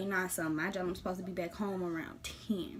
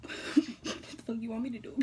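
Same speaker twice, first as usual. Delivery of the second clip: laughed through, exasperated; laughing question; 2 semitones higher than usual. Retold or imitated. Retelling her answer to him, laughing. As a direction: ask it while cracking up.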